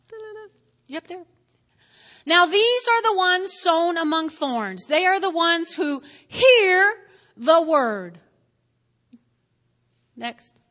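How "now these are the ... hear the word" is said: loud, deliberate reading of the verse; scripture reading. Acted. Pastor reads the verse to the congregation, loud and deliberate.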